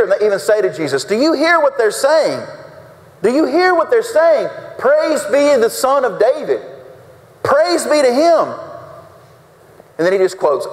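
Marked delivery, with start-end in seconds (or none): 1.0-8.5 high-pitched, indignant mimicry; ticked-off Pharisees. Religious leaders complaining to Jesus about the children, ticked off. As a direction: act scandalized, voice pitched well up. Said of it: "get ticked off".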